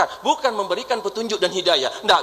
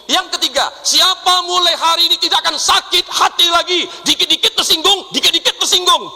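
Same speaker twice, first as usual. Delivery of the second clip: high, loud fiery call; rousing the congregation at full voice; preaching shout; 8 semitones higher, 6 dB louder than usual. Real, not acted. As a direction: build to a fierce preaching shout. Preacher shouting rhetorical challenge.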